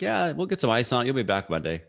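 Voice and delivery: high-pitched mock trainer, brushing off the injury; breezy high school trainer. Mocking the trainer who shrugged off his broken foot, high and breezy. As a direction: jump way up in pitch like a dismissive trainer. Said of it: "Trainer's like, typical high school trainer".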